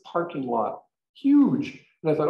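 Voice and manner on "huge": emphatic exclamation. Real, not acted. Describing massive parking lot.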